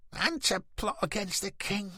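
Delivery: high-pitched jeering crowd voice; accusing heckler. A narrator's reading. Another heckler adds an accusation.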